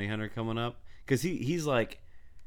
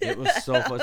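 laughing; 7 dB louder than usual. Real, not acted.

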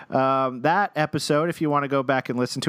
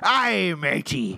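pirate voice; 2 semitones higher, slower than usual. Pretend. Joining pirate bit.

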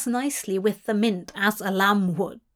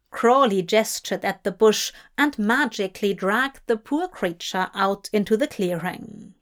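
ordinary delivery

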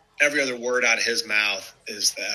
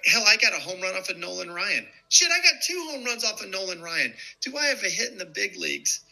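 indignant brag; 7 semitones higher than usual. Retold or imitated. Pitcher indignantly listing his hits.

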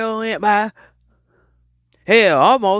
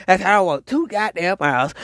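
normal talking voice

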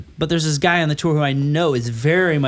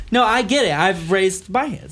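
normal talking voice

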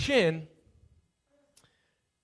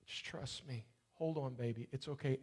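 put-on much softer, gentle comfort to a child; tender hush; 3 semitones lower, 11 dB quieter than usual. Father comforts his hurting daughter, hushed and tender. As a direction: soothe her like a frightened little girl.

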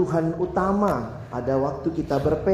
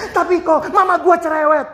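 much higher, loud whine; whiny Jakarta teen; 9 semitones higher, 6 dB louder than usual. Retold or imitated. Teenager whines to the pastor that his mom nags, high and loud. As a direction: complain about nagging mom.

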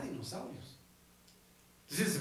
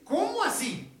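higher, louder, fired-up challenge; lively retold Jesus voice, higher and louder; Jesus challenging; 7 semitones higher, 4 dB louder than usual. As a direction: preach it as a bold rebuke of doubt. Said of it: "Le dice".

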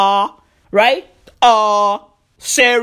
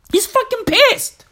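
high-pitched echo; 11 semitones higher than usual. Acted. Host confirming Lefty is pissed, high comic voice.

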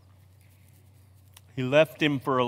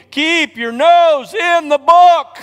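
much higher and louder, slow; preacher yelling his lifelong advice; shouted command; 13 semitones higher, 12 dB louder, slower than usual. Real, not acted. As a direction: bellow it like a drill sergeant.

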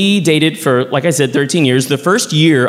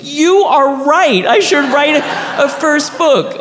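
much higher, slow, hammed-up realisation; mock awed agreement; 12 semitones higher, slower than usual. To his husband, slow high mock-awed agreement. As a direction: ham up being won over.

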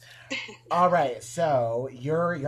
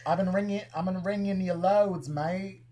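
higher-pitched go at British slang; attempted British accent; 4 semitones higher than usual. Shown as a demonstration. Host attempting a British accent for the British guest, mock British. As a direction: put on a chummy British accent. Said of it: "Can you guys do a British accent?".

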